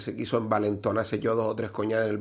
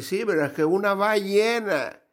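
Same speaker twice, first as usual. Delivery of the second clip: higher insistent voice; higher, insistent drunk; insisting; 10 semitones higher, 3 dB louder than usual. Acted. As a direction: stubbornly insist.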